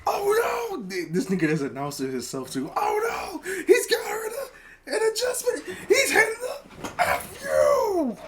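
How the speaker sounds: high excited commentator yell; announcer call. Acted. Mock commentator calling the move on grandma, high and laughing. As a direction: scream it like a ringside announcer, laughing.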